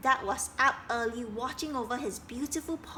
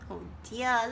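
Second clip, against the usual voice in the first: ordinary delivery, nothing marked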